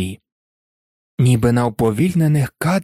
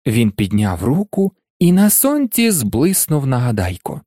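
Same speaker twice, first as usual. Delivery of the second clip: ordinary delivery, nothing marked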